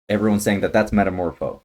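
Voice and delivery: ordinary delivery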